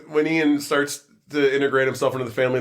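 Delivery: ordinary talk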